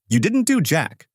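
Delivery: understated yell; yelling back. A narrator's reading. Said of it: "yelling back".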